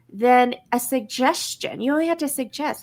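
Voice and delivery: ordinary talk, nothing marked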